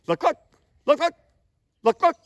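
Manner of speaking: quick two-syllable LUCK-LUCK bursts; sped-up double cluck. Shown as a demonstration. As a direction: LUCK LUCK fired off as a fast double note. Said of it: "You start speeding that up. Now I'm".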